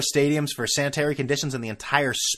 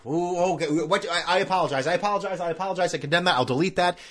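higher, hammed-up sorry-not-sorry tone; mock contrite apology; 7 semitones higher than usual. Host fake-apologizing to listeners for calling them you people, hammed-up. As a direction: play at grovelling to the audience.

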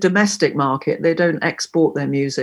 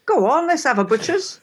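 higher, lightly Cockney coaxing; cheeky Cockney; 8 semitones higher than usual. Shown as a demonstration. Teaching Cockney slang, playful higher coaxing. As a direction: egg them on like a London mate. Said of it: "I'd say".

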